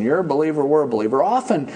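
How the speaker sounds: usual speaking voice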